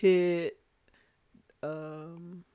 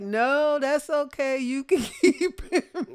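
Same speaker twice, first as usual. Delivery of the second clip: higher and louder, laughing it off; laughing refusal; 8 semitones higher, 10 dB louder than usual. Retold or imitated. Parents turning down the teenage son, laughing no thanks. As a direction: turn down the offer through a laugh.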